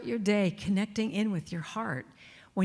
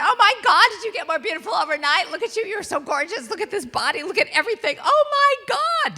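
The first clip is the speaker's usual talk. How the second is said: much higher and louder, rapturous self-admiration; gushing at the mirror; 12 semitones higher, 9 dB louder than usual. Retold or imitated. To her reflection in the mirror, a high loud gushing squeal. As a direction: squeal with delight at your own reflection.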